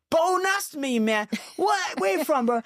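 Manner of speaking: high-pitched, hyped-up American greeting; big, high, punchy bro energy; imitating excitable stranger. Host imitating a stranger greeting him, high and hyped.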